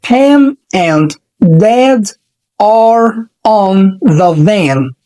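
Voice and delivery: Pam and Dad are on the van said very slowly, word by word; slow teaching read. Shown as a demonstration. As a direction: spell it out slowly for learners. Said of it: "بننطق بالراحة جدًا".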